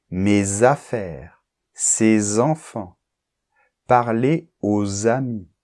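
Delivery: slow, careful examples with a z liaison each time; teacher dictating the examples. Shown as a demonstration. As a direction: read each pair slowly to model the linking. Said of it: "entre un déterminant et un substantif".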